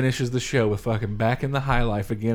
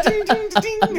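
imitated sitar; slower than usual.

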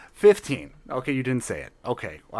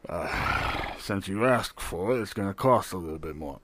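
low gruff barkeep; 7 semitones lower, 4 dB quieter than usual. Acted. Barkeep charging extra.

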